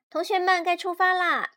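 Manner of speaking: high, loud rallying call; excited teacher's call to her pupils. A narrator's reading. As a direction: call the class to set off. Teacher calls class to set off.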